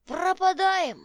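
put-on loud, slow, high old man's cry; old prospector. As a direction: bellow it like an old codger. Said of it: "повторил он".

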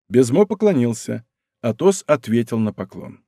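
ordinary delivery

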